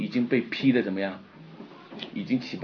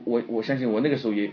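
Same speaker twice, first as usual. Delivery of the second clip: ordinary delivery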